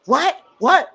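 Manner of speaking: rising, high repeated disbelief; imitating women in disbelief; mocking shocked women on a panel; mock outraged what. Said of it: "They was like".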